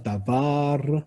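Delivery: "다바르" with 바르 stressed and stretched; final-syllable stress. Shown as a demonstration. Teacher demonstrating late Hebrew stress, "다바르" stressed on the last syllable. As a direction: lean into the end of "다바르". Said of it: "강세가 뒤에 오고요".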